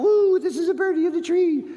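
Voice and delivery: very high, sing-song little bird; birdie voice for grandkids. Retold or imitated. Grandpa reading to grandkids, high birdie voice. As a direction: chirp it like a storybook bird. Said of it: "kind of taking on the character".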